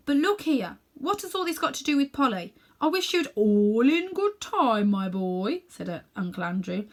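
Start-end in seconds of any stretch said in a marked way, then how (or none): 0.1-3.3 put-on impatient boy. 3.3-5.6 stalling slowly. Acted. Uncle stalling.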